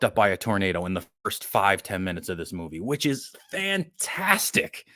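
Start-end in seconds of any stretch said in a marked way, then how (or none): 2.8-4.9 high, slow, drawn-out mock glee; sarcastic delight. Real, not acted. Reviewer relishing the annoying characters' deaths, slow and sarcastic. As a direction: savour the word like it's the best news ever.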